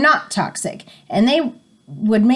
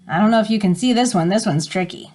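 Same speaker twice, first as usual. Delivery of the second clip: ordinary delivery, nothing marked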